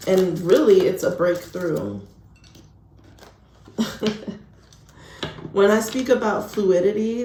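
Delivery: ordinary talk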